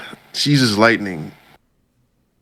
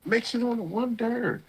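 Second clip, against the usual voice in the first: sarcastic; 9 dB quieter than usual. Real, not acted. Sarcastic aside on cheesy moment.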